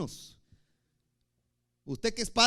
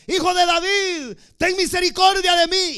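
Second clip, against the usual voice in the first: high, loud begging cry; desperate shout; 12 semitones higher, 12 dB louder than usual. Retold or imitated. Blind Bartimeo crying out to Jesus for mercy, loud and desperate. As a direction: beg at the top of your voice for mercy. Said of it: "Y va de gritar".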